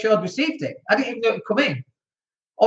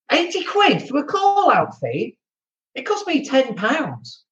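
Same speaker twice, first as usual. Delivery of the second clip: incredulous; 6 semitones higher, slower than usual. Retold or imitated. Mock outrage at plumber's fee.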